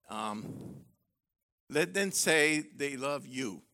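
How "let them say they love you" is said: normal talking voice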